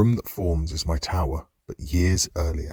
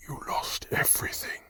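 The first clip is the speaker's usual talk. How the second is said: soft unvoiced whisper; 9 dB quieter than usual. A narrator's reading. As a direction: breathe it out as a whisper. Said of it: "the system whispers".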